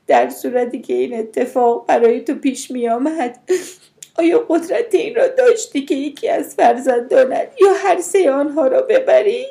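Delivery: high, loud, tearful challenge; distraught pleading. A narrator's reading. Mother asking if her sister could do the same, tearful.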